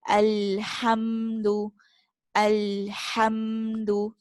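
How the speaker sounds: "Alhamdu" very slow, airy middle throat Ha. Shown as a demonstration. Teacher modelling Ha in Alhamdu. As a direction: breathe the Ha in "Alhamdu" slowly. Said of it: "feel the air in your middle throat".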